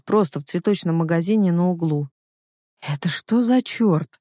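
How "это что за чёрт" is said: slow, higher-pitched bafflement; dazed, incredulous question; stunned mutter. A narrator's reading. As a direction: draw it out, dumbfounded. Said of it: "ошалело пробормотал Илья".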